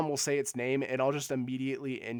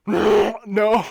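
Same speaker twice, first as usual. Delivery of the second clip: loud, higher-pitched fake monster roar; botched monster growl; 8 semitones higher, 15 dB louder than usual. Shown as a demonstration. Streamer doing a requested T-Rex impression, botched loud monster growl. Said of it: "gonna do a generic monster growl".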